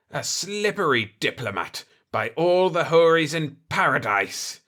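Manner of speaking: mildly grating, a touch out of breath; grated, breathing hard. A narrator's reading. The winded Aga Khaga sneers at Retief, grating mildly. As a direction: grind the words out after the scuffle. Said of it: "he grated, breathing hard".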